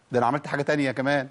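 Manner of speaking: usual speaking voice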